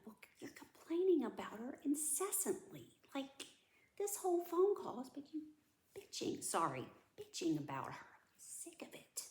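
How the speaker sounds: imitating your own exasperated complaint, voice giving out at the end; retold rant. Retelling a rant at dad over the sitter, exasperated. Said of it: "I started ranting".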